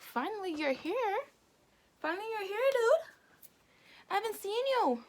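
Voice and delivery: high, loud, thrilled friend's welcome; mock-excited high-pitched dude greeting. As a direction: squeal it like a friend overjoyed to see him. Excited friend greeting him back.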